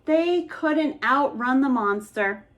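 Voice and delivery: ordinary delivery, nothing marked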